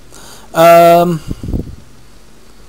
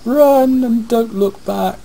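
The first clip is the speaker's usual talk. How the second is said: dramatic slow warning; 9 semitones higher, slower than usual. Pretend.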